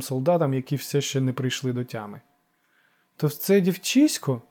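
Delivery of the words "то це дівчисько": high, slow incredulous question; astonished, rising question; surprised. A narrator's reading. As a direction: ask it in drawn-out disbelief. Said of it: "здивувався Ефікл".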